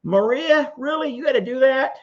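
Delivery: high, loud mock-cranky voice; old cranky lady. Streamer teasing Maria in the chat, hammed-up cranky old lady. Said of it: "the old cranky lady voice always gets me".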